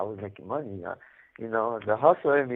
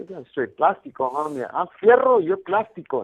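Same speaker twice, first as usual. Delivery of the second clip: taunting; 4 semitones higher, 8 dB louder than usual. Retold or imitated. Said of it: "I'll tell them".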